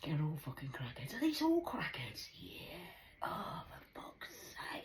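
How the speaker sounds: dismayed whisper. Real, not acted. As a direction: mutter it under your breath. Whispered asides.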